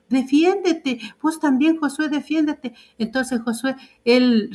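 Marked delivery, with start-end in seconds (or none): 0.1-2.7 higher-pitched, slowed urging on defiéndete; lightly voiced Anita pushing him to stand up; urging him to defend himself. Anita telling Josué to stand up for himself, higher urging voice. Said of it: "decía".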